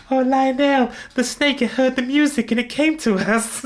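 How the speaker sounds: put-on high, childlike falsetto; put-on high pop-star voice. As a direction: tell it in wide-eyed falsetto. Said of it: "he's just like".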